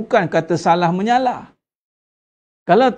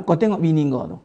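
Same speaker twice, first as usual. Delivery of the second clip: devil whispering; 5 semitones lower, faster than usual. Retold or imitated.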